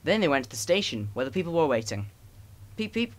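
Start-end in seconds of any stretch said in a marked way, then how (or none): 2.8-3.1 high, light peep peep like a little engine whistle; whistling engine. A narrator's reading. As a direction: toot it high like a small train. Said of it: "whistled Edward".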